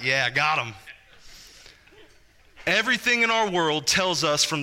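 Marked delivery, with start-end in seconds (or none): 0.0-0.7 lower, smug little aside; a baby pleased with his conquest; voicing the smug baby. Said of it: "you can tell he's like".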